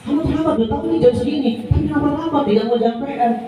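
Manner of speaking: high, fast scolding of a late pupil; scolding teacher. Shown as a demonstration. Teacher demonstrating how not to scold a late pupil, high and fast. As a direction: snap at the latecomer without asking why.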